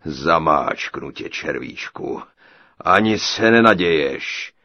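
low, slow menacing ogre; threatening giant. A narrator's reading. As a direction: crush him with your voice. (výhrůžný obr)

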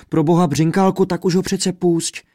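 high, urgent begging; prosebně, vysoko; pleading. A narrator's reading. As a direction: beg him to let father go. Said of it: "prosila Světluška".

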